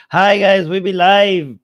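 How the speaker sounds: loud hoarse greeting; sick voice. Real, not acted. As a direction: belt the greeting through a sore throat. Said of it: "I'm sick, so this is my voice".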